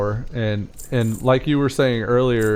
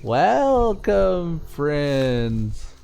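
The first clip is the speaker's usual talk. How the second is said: put-on stoner goblin girl; 4 semitones higher, much slower than usual. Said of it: "stoner girl goblins are like".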